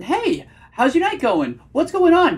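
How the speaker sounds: high, drawn-out "Hey", voice climbing on "going on"; over-eager, high and slow questioning; nervous octave-up tonality. Shown as a demonstration. Coach mocks a nervous guy greeting a date, nervous high pitch. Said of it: "you can just hear the nervousness".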